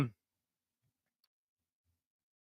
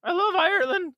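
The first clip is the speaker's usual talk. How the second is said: high Irish imitation. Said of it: "she loves Ireland".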